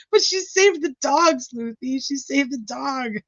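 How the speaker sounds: high whiny pleading, softening at the end; high childish whine pleading for the dog rescuer; mock whiny plea. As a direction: whine to defend the girl. Her own pleading reply to Sleuthy.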